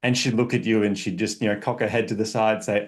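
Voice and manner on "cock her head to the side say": ordinary delivery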